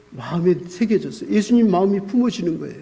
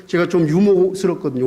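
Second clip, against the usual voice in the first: ordinary talk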